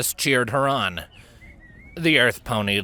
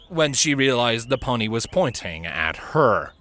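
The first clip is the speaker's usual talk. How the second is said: usual speaking voice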